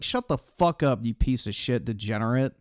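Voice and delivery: ordinary talk, nothing marked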